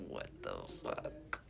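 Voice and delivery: slow whispered disbelief; stunned whisper. Retold or imitated. Woman privately reacting to date's confession, stunned whisper. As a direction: whisper it slowly, dumbfounded.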